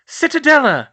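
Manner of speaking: high, loud shield cry; spell shout. Acted. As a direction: raise the shield forcefully. Casting shield.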